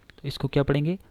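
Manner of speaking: ordinary delivery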